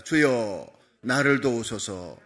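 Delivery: slow, low call-and-response prayer line; slow lead-in for repeating. Shown as a demonstration. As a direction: say it slowly so the congregation can follow "주여, 나를 도우소서". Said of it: "따라합시다".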